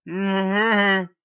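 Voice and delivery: high strained hum around a gun barrel; squeaky muffled mm-hmm, mouth full; muffled high hum through a gun. A narrator's reading. Narrator with a gun in his mouth, squeaking a muffled hum. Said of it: "It comes out as".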